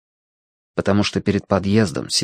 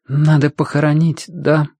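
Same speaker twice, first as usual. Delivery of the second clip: ordinary delivery, nothing marked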